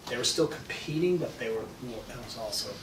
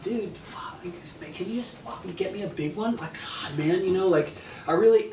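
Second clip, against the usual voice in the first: frustrated plea; 7 semitones higher than usual. Retold or imitated. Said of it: "I said to Mike".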